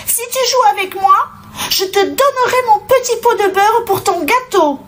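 put-on very high, fast child's voice; little girl. A narrator's reading. Little girl bargaining with the wolf for play, eager. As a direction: chirp the offer quickly, pitched high. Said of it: "lui proposa la fillette".

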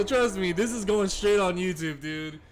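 ordinary delivery

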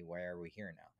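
normal talking voice

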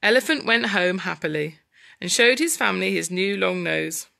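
put-on lively story narration for children; dramatic storytelling. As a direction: read the tussle with big dramatic swings.

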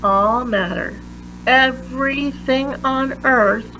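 ordinary delivery, nothing marked

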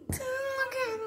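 very high, slow, soft cooing; high soft pet-talk coo; baby talk to dog. Acted.

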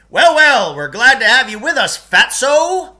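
mock the fat kid in front of the class; sneering gym teacher. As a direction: gym teacher jeering at a late pupil. Gym teacher mocks pupil.

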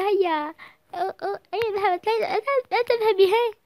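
normal talking voice

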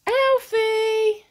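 loud high call across the room; sing-song shout for the dog. Real, not acted.

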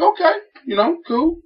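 high, forced casual okay; fake-cool okay. Dad pretending to be fine with the boy, high and forced. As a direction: pretend it's no big deal.

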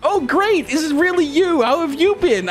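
high, loud, overjoyed greeting; joyful. Acted. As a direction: gush with delight at an old friend. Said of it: "I said joyfully".

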